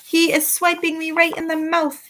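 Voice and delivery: yelled. A narrator's reading. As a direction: complain furiously. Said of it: "yelled the meat dripper".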